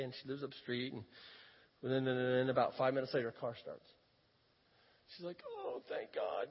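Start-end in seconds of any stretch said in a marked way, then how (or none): 5.5-6.5 high, slow, soft sigh of thanks; retold high-voiced relief; relieved. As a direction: imitate a grateful woman's relief, drawn out. Acting rescued driver's relief.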